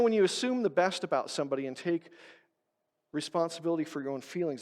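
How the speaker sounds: ordinary delivery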